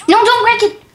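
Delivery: high, loud urgent cry; urgent protest. Real, not acted. Player stopping a friend in the game, urgent protest. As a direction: cry out to stop them at once. Stops friend breaking something.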